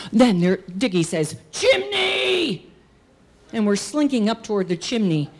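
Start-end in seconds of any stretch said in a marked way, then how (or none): 1.5-2.7 high, long shouted command; drawn-out high yell; boy's rallying cry. Acted. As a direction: holler the next target to the gang. Boy calls out next destination.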